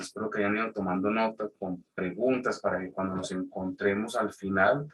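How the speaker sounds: ordinary talk, nothing marked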